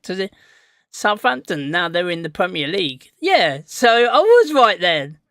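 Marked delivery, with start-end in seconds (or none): 3.2-5.2 slow, louder, triumphant; smug told-you-so. Real, not acted. To viewer after chat correction, slow smug gloat. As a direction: savour being proven right. Proved right about Southampton.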